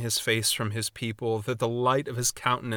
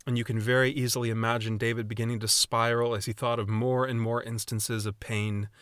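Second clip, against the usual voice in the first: ordinary delivery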